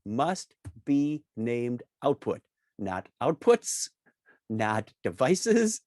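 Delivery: deliberate, with every word weighted; slow, emphatic. Real, not acted. Presenter correcting likely naming mistakes, slow and emphatic. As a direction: drive home the exact name, unhurried. Stressing the exact required name.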